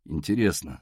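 low, musing remark; thoughtful. A narrator's reading. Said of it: "задумчиво сказал человек". (задумчиво, низким голосом)

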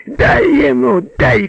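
high-pitched excited yell; shouting. A narrator's reading. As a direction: cheer the beating on loudly. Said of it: "кричал Агеич". (азартный крик)

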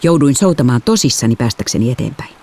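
usual speaking voice

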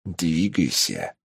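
low, slow, soft hiss; шипя на ухо. A narrator's reading. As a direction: hiss the order into his ear. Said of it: "прошипел ему на ухо Тапок". (тихо прошипеть на ухо)

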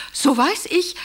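ordinary talk

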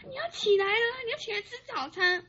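grandma waking her grandchild, nagging. Retold or imitated. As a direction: pitch up like a nagging granny. Grandma voiced nagging on phone.

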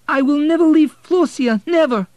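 put-on high, loud boy's cry of refusal; desperate protest. Boy refusing to leave his sister, high loud protest. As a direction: refuse with everything you have.

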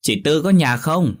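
high, loud call into the house; calling out loudly. A narrator's reading. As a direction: call out to the neighbour. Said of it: "Bà hỏi to".

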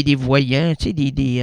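ordinary talk

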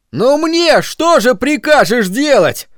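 high, slow desperate moan; despairing moan. A narrator's reading. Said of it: "простонал в отчаянии Бернард".

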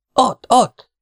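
high, loud cry of alarm; terrified shout. A narrator's reading. Partisan spots a scorpion creeping along, terrified high shout. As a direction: shout out in sudden fright, pitch leaping up. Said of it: "kiabálta rémülten".

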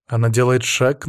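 usual speaking voice

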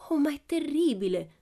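high, dismayed cry; upset gasp of pity; distressed. A narrator's reading. Pippi moved by the countess, high dismayed cry. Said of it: "disse Pippi, e gli occhi le divennero abbastanza rossi".

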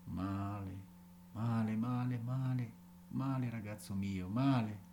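father sadly rebuking his son; sad scolding. A narrator's reading. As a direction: scold softly, heartbroken. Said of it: "si fece triste e melanconico".

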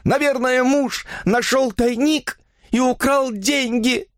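high, slow, anguished woman's voice; aghast, pleading guess; horrified realization. A narrator's reading. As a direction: explain desperately, pitched high. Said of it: "в ужасе проговорила она".

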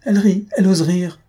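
high, loud, scandalised yell; outraged shout. A narrator's reading. Irascible husband rages that the girl dares laugh, shouting. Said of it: "vociféra notre porc-épic".

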